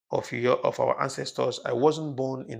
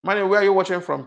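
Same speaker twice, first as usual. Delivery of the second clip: loud, raised-pitch call to a viewer; 2 semitones higher, 10 dB louder, faster than usual. Real, not acted. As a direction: call out loudly for an answer. Calling to a named live viewer.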